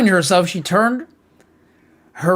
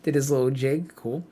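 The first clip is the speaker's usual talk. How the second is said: ordinary delivery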